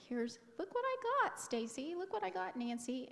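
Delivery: high, loud, singsong boast; excited look-what-I-got call; showing off. Retold or imitated. Acting out showing off to coworkers.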